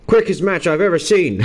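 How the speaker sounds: half-hearted Irish commentator; attempted Irish accent. Streamer trying his best Irish accent on the wrestling result. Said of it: "I will try my best Irish accent".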